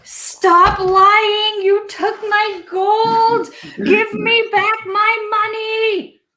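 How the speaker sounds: put-on high, screechy drunken goblin demand; angry goblin. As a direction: screech slowly, stretching out the accusation.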